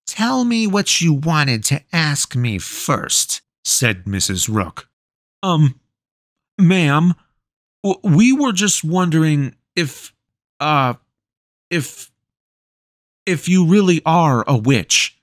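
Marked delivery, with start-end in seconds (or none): 0.1-3.4 lightly voiced stern crone; a hint of the witch's command; witch demanding. A narrator's reading. 5.4-15.2 put-on high, halting boy voice with long pauses; a scared boy asking the witch an awkward question; nervous stammer. A narrator's reading. Hesitant boy voice.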